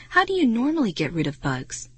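usual speaking voice

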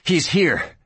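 high, contained yell; yelled alarm. A narrator's reading. Boy rushing into the saloon to warn the sheriff, yelling. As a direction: call out the warning, pitched well up. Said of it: "and he yelled".

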